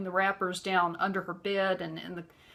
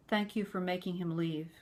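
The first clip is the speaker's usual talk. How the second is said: put-on softer, grave thanks of a child; very serious; 6 dB quieter than usual. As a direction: say it gravely and quietly. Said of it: "she said it just like that, very serious".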